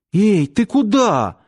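high, slow startled call; flustered call. A narrator's reading. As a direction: call after it, caught off guard. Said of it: "растерялась девушка".

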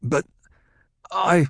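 a man too moved to finish; broken stammer. Acted. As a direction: falters and breaks off. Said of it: "Gurney spoke brokenly".

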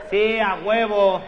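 laughing. Real, not acted. Laughing exaggerated agreement.

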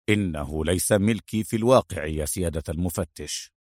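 low, subdued vexation; annoyed. A narrator's reading. Adham denying the gun is his, quietly annoyed. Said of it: "وقال بضيق".

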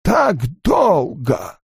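high, very slow eerie murmur; Aginor. A narrator's reading. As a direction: drag it out like an ancient ghost. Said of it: "тихо произнёс Агинор".

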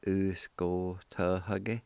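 "U-s-ko-te-ha-ke" drawn out very slowly, each syllable apart; slow it right down so students can follow. Shown as a demonstration. Teacher slowly modelling the word for students, syllable by syllable. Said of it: "It's pronounced like this".

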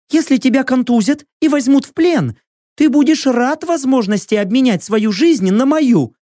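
high, firm warrior woman's voice; a proud captive bargaining; proud female warrior. Acted.

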